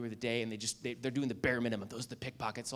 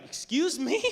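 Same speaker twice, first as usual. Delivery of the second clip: offended excuse me; 11 semitones higher, 6 dB louder, slower than usual. Retold or imitated. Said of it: "but she was like".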